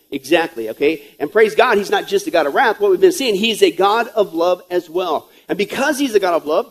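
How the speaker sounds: usual speaking voice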